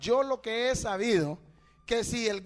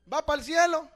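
loud, challenging repeat of the question; louder repeat; 5 semitones higher, 6 dB louder than usual. Real, not acted. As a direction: hit it harder the second time. (repetición más fuerte y desafiante)

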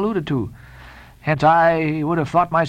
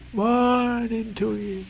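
high, very slow, softer "Morning to ye"; Elijah's receding farewell; 7 semitones higher, 4 dB quieter, much slower than usual. A narrator's reading. Elijah moving off, high slow goodbye, fading. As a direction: let the parting fade as he moves off. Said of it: "moving off".

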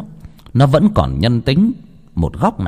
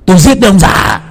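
defiant yell; 8 semitones higher, 7 dB louder than usual. A narrator's reading. Drunk son yells at father.

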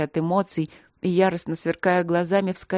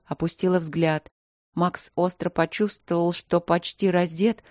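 plain reading voice

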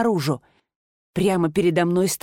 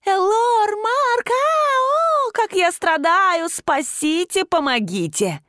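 very high mocking squeaky falsetto; 12 semitones higher, slower than usual. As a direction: squeak like a helpless damsel. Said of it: "изобразил писклявым голосом".

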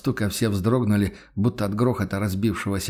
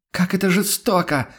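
shouted; 8 semitones higher than usual. A narrator's reading. The voice behind the door shouts at the inquisitor, mocking cry. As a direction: cry it out with mock hurt. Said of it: "прокричал всё тот же голос".

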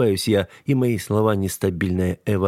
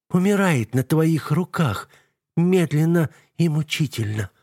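hissing broken whisper; 8 semitones higher than usual. A narrator's reading. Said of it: "свистящим, срывающимся шёпотом".

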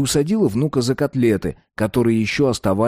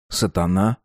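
ordinary delivery, nothing marked

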